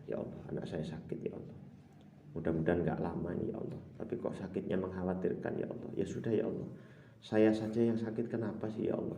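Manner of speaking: soft whispered pleading prayer; hushed prayer. Retold or imitated. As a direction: murmur the worries under your breath. Mother confiding to God.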